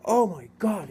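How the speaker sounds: high, loud horrified exclamation; imitating a clerk who is stunned; shocked gasp. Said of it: "he goes".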